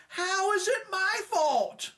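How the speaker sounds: high-pitched outraged wife; mock yelling back. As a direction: hurl accusations in a put-on high voice. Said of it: "she yelled back".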